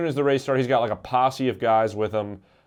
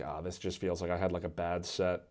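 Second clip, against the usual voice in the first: much softer, dejected inner voice; embarrassed comic muttering to himself; deflated mutter; 5 semitones lower, 12 dB quieter than usual. Retold or imitated. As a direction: say it to yourself after a flop. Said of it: "I'm like".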